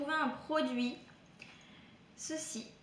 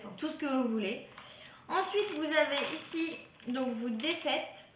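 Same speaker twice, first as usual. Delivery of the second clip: normal talking voice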